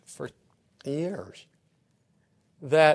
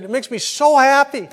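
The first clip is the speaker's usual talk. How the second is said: high and loud, a touch of delight; happy gush; 11 semitones higher, 12 dB louder than usual. Retold or imitated. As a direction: beam it out like a passerby. Cheerful lady passing on walk.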